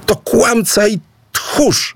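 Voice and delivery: shrieking accusation. A narrator's reading. Aboe screams at Idu in front of the village. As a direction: scream it to brand him a coward. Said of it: "wrzasnęła Aboe".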